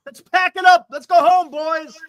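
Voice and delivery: high, loud sarcastic rallying call; mock retreat order. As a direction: call off the troops in mockery. Mocks co-host's wish to retreat.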